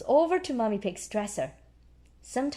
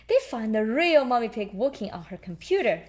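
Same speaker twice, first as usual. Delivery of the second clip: usual speaking voice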